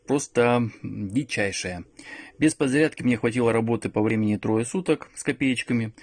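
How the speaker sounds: ordinary talk, nothing marked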